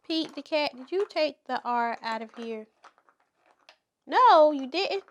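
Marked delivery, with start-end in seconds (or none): 4.1-5.0 much louder and higher, playful disbelief; loud, high playful surprise; mock surprise. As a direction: act astonished for the kids. Mock surprise at pretend cat.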